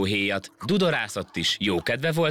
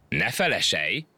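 plain reading voice

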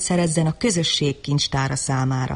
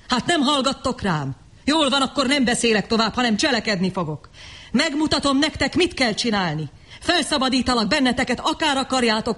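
fiery defiance; 7 semitones higher than usual. Acted. Savage declares he will act.

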